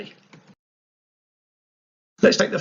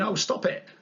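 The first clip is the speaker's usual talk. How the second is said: coy, higher-voiced protest; coy response; 5 semitones higher than usual. Cute cartoon angle replying to a remark, coy. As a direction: play a lightly put-on coy reply. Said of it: "its response is, it's just going".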